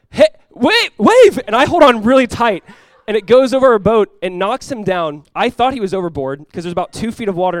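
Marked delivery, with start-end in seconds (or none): 0.1-1.4 high, loud cry of warning; retold shout, sudden and urgent; alarmed warning shout. Warning the boss about a looming wave, alarmed shout.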